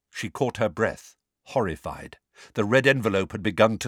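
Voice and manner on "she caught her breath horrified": plain reading voice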